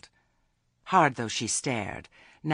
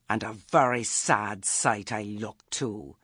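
ordinary delivery

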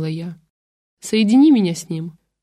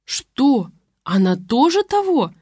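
higher, a touch of glee in the question; gleeful tone; 6 semitones higher, slower than usual. A narrator's reading. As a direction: ask hoping for the bad news, gleefully. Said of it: "ликующим тоном спросила Нина".

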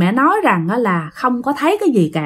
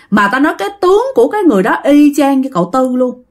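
ordinary delivery, nothing marked

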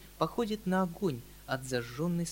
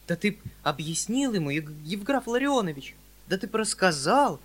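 anguished reproach; 5 semitones higher, 6 dB louder than usual. Acted. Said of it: "с тоской и упрёком".

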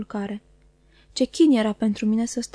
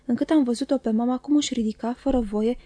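usual speaking voice

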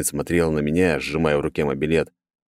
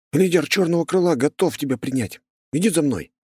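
breathless attempt at an official tone; trying to sound formal while panting; out of breath; 9 semitones higher than usual. A narrator's reading. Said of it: "постарался придать своему голосу официальный тон, но одышка".

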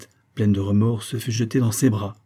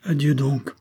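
raised, sorrowful yet firm farewell; pained but resolute; 5 semitones higher, slower than usual. A narrator's reading. Man leaving Antoinette for good, pained and resolute. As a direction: say goodbye hurt but decided. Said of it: "avec un accent douloureux, mais ferme et résolu".

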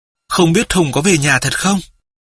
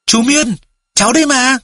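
much higher, eager call across the room; boy calls to his uncle in the next room, much higher and eager; calling loudly; 8 semitones higher than usual. A narrator's reading. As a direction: shout out to the uncle you recognise. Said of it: "Nam đứng lên gọi to".

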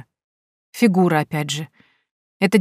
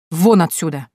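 barked order; 3 dB louder than usual. A narrator's reading. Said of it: "рявкнул граф".

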